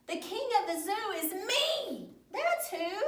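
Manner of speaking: high, storybook indignation; hammed-up angry boast for children; hopping mad. A narrator's reading. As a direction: insist on it like an affronted little king. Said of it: "Carlos was hopping mad".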